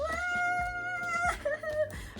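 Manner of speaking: high-pitched giddy squeal laugh; long thrilled squeal breaking into laughter; excited squeal. Real, not acted. Excited squeal at publication news.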